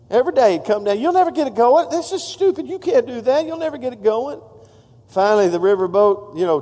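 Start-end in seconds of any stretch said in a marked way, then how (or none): 1.0-4.4 mocking taunt. Scoffer taunting the steamboat builder.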